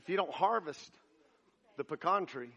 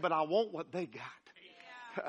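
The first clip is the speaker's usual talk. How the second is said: woman's quiet plea; 3 semitones lower, 4 dB quieter, slower than usual. Acted. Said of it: "And she said".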